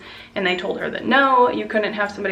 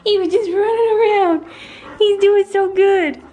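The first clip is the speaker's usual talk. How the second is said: proud gushing; 10 semitones higher, 7 dB louder than usual. Real, not acted. Owner telling viewers proudly about her dog, in gushing high voice. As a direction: gush with pride over the dog.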